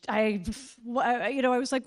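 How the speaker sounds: ordinary delivery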